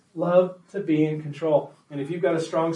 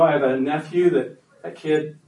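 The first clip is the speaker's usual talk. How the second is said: ordinary talk, nothing marked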